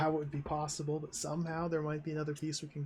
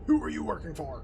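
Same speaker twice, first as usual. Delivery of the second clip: louder, hard fall on who; stern demand; 5 dB louder than usual. Acted. Guard questions the new medic, loud stern demand. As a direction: interrogate the captive with a firm demand.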